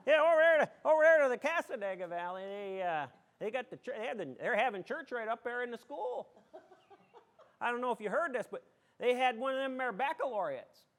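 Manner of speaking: loud, folksy small-town rumour-spreader; hammed-up local gossip. Townsperson spreading news of church in school, hammed-up gossip.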